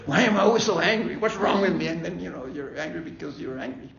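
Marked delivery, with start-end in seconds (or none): 0.1-1.9 loud, higher, frustrated outburst at yourself; exasperated self-reproach. Retold or imitated. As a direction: blurt it out, fed up with your own anger. Quoting own exasperated inner voice.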